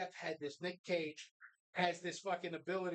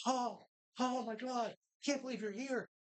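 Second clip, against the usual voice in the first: mock awe; 3 semitones higher than usual. Crowd imitated greeting Nick at fight club, mock awe.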